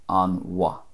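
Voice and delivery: "an wa" with a W sound; non-Munster "an wa", W for M H; other dialects' pronunciation. Shown as a demonstration. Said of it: "would be a W sound".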